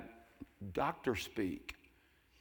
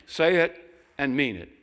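exhortation; 4 semitones higher, 9 dB louder than usual. Real, not acted. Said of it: "exhorting the congregation".

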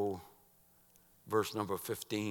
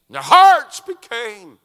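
high, shouted sermon delivery; loud preacher at full pitch; full-force preaching; 13 semitones higher, 26 dB louder, slower than usual. Real, not acted. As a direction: thunder it out to the congregation.

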